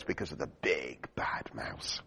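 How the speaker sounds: put-on whispered warning to a child. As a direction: hush it like a scary secret. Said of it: "the father Gruffalo explains to the little child".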